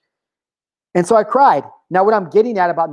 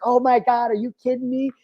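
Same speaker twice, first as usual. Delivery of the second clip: mildly amazed mom, higher pitched; 7 semitones higher, 3 dB quieter than usual. Retold or imitated. Mom hearing her son's prediction came true, amazed. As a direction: imitate a mom's disbelief, higher. Mom's amazed reaction in story.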